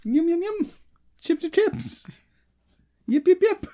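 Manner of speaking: high silly critter voice; squeaky creature voice. Pretend. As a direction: chirp it like a tiny critter.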